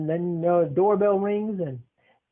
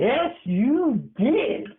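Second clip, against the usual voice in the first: slow, higher sing-song taunt; teasing sing-song; 7 semitones higher, much slower than usual. Real, not acted. Grandson taunting grandma who denies it, slow sing-song. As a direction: drag it out to tease her. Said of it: "teasing insistence, drawn out".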